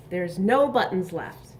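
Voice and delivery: normal talking voice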